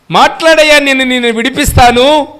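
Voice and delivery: Pilate urging. Acted. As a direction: press him to speak, loud and high. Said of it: "పిలాతు".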